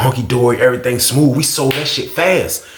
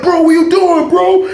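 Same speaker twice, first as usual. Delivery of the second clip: much higher, louder alarmed cry; 4 dB louder, slower than usual. Retold or imitated. The cousin crying out as he's grabbed, high alarmed. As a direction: yelp it out in alarm. Roommate grabbing him, startled.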